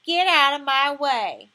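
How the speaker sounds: loud, slow commanding yell. Acted. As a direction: bellow it to clear the way. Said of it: "Then you yell like this".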